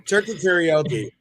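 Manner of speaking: laughing. Real, not acted. Joking about turkey karaoke.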